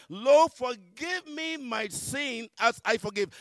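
loud, high, drawn-out recital; impassioned prayer. Retold or imitated. Reciting the Lord's Prayer to God, impassioned. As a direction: recite it slowly at full voice.

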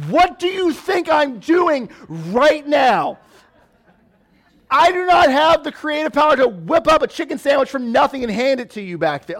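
hammed-up parent rant at the kids; exasperated parent. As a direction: drag out each word like a fed-up parent. Frustrated parent answering kids.

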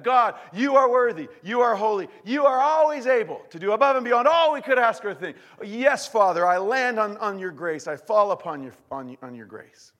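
high, loud worship; fervent prayer. Acted. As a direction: praise God with full heart. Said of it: "Let's pray".

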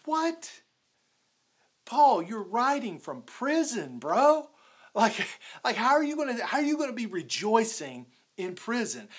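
high, loud disbelief; incredulous. Acted. Preacher questions Paul rejoicing in prison, incredulous. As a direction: challenge him in mock astonishment. Acts out disbelief at Paul's words.

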